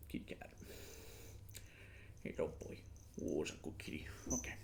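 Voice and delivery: soft cooing to the kitty. Real, not acted. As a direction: coo gently at the cat. Talking to the cat.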